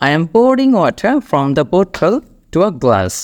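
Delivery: very slow, careful model sentence; slow demo sentence. Shown as a demonstration. Teacher modelling an example sentence, very slowly. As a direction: say it slowly so learners can follow. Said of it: "what I am doing now".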